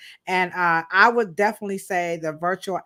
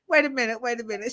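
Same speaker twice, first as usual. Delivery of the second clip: ordinary talk, nothing marked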